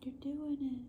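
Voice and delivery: high, hushed movie-line imitation; movie quote. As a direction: softly squeal an encouraging film quote. Quoting a line from Hook.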